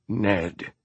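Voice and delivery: a strange, almost pained note on the name. A narrator's reading. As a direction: say the name as if it still hurts, held back. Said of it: "a strange, almost pained tone".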